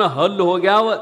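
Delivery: ordinary delivery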